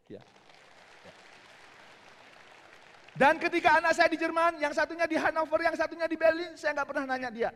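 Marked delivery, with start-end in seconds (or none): 3.1-7.5 loud, high, building speech; rousing speaker's call; raised voice. Real, not acted. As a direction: project it to the whole hall. Motivational speaker raising voice.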